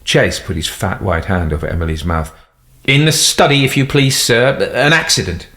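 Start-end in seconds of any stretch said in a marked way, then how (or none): 2.8-5.5 lightly voiced deferential butler, a bit higher; a hint of a nervous servant; servile butler. A narrator's reading. Butler answers the secretary.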